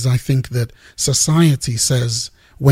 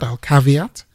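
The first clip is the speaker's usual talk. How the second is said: normal talking voice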